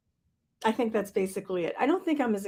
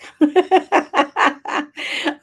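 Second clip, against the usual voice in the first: loud burst of laughter; 8 semitones higher, 6 dB louder than usual. Real, not acted. Laughs at own remark.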